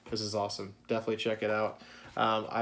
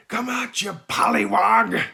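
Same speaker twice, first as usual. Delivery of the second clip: high, loud taunting yell at the crocodile; yelling captain; 11 semitones higher, 10 dB louder than usual. Retold or imitated. Vlogger quoting the captain calling the crocodile, high loud yell. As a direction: bellow it out like a crazed old sea captain. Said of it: "He's like".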